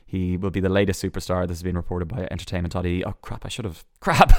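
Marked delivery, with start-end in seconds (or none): 4.0-4.3 high, loud burst of annoyance at himself; loud, high self-rebuke on realising he forgot something; sudden exclamation. Real, not acted. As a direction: blurt it out as you catch the slip. Catches his own mistake.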